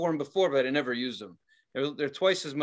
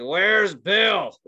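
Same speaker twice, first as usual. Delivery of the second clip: higher, louder yell out of sleep; loud mock shout; 4 semitones higher, 7 dB louder than usual. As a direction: blurt it loudly as if just woken. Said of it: "Like, loud and".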